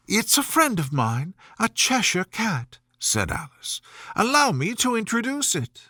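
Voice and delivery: put-on a young girl complaining, a bit breathless with fuss; Alice, voiced lightly. A narrator's reading. Alice introduces the cat.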